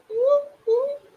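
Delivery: very high, excited whooping cry. Real, not acted. Vlogger greeting North Carolina viewers, high excited whoop. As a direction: cheer it like a hype call. Shout-out to viewers' home state.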